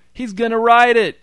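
very high, loud thrilled yell; excited shout. A narrator's reading. Grown man in the crowd cheering the gorilla, excited shout. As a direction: shout with childish delight. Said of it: "shouted a grown man".

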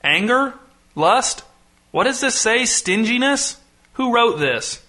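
high, rising, baffled questions; puzzled. A narrator's reading. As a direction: query each word as if it is wrong. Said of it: "furrowing his brow".

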